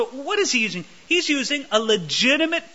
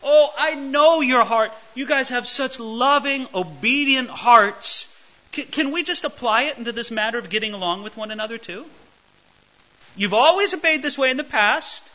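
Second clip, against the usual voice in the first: loving encouragement; 6 semitones higher, 4 dB louder than usual. Retold or imitated. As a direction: coax them gently like a loving pastor. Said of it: "He goes".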